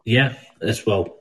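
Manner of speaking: low, soft Scottish accent; real Scottish accent. Real, not acted. Insisting he's really from Scotland, real Scottish accent. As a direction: speak low in your own Scottish accent. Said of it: "This is my real accent".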